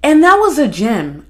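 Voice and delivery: ordinary talk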